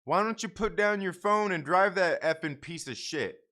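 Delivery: loud angry yell, pitched high; yelling at a careless driver. Retold or imitated. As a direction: bark it across the yard at the driver. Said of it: "I just yelled at him".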